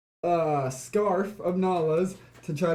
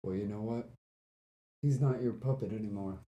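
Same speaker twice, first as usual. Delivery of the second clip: low, hushed challenge; soft, deep-pitched in-character retort; low defiance; 7 semitones lower, 8 dB quieter, faster than usual. Acted. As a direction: quietly stand up to the villain. Player's character defies the caged man.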